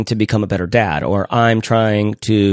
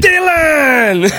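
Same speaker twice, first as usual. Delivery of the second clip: mock yell. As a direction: bellow the name in fun. Friends yelling name in jest.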